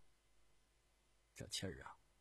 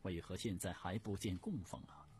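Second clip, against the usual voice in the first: displeased hungry Empress; 4 semitones higher, 8 dB louder than usual. Acted. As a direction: demand an explanation as an imperious Empress. Hungry Empress demands food. (太后不悦地责问)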